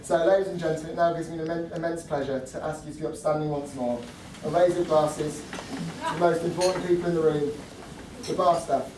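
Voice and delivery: loud, proud toast to the couple; wedding toast. Acted. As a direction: raise the glass and call it out. Raises glasses toast.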